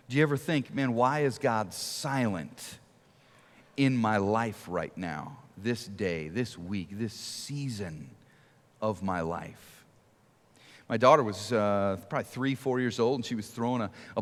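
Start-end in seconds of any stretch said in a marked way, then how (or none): 0.7-9.9 lower, softer, slow and sinking; a tired prayer fading to almost nothing; weary lament. Retold or imitated. A doubter asking God why he stays silent, low and fading.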